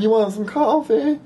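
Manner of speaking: put-on high girl's voice offering coffee; girly offer. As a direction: offer it sweetly. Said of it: "And she's like".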